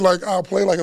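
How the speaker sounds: usual speaking voice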